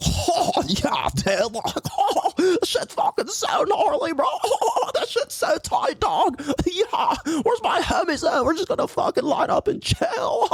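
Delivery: high laughing stoner bro voice; hammed-up Californian surfer dude; mock surfer bro, very high and laughing. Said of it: "I sound like".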